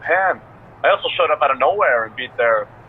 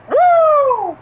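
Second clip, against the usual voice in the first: high, loud Flair-style woo; excited wrestling woo; 5 dB louder than usual. Pretend. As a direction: let out a big woo. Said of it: "once you start wooing".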